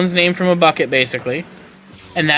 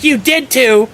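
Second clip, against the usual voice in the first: angry retort; 11 semitones higher, 4 dB louder, slower than usual. Retold or imitated. Boss insisting angrily.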